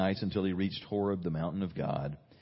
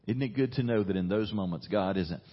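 ordinary talk

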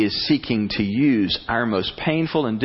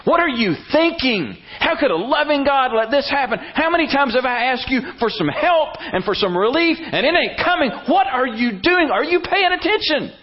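much higher, louder desperate protest; anguished complaint to God; 13 semitones higher, 3 dB louder than usual. Retold or imitated. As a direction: cry out at God in hurt. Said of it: "It was to say".

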